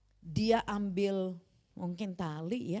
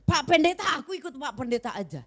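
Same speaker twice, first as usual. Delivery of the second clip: eager plea; 5 semitones higher, 8 dB louder than usual. Retold or imitated. Mother pleads to join pastor.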